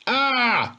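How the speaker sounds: high alarmed yelp; frightened cry. A narrator's reading. Boy scrambling away from the druid, high yelp. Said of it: "he cried again".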